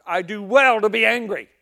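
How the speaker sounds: loud, high, sulky shout; petulant shouted defiance; angry Jonah. Acted. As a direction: snap back like a furious prophet. Jonah's angry retort to God.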